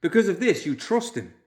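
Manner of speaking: put-on young man exploding in protest; burst out. A narrator's reading. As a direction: blurt it angrily at the group. Loomis continues angrily.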